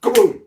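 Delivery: loud, high "kaboom" burst; booming crash sound effect; explosive crash noise. A narrator's reading. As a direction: imitate a big collapse with a sudden boom. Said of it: "It went".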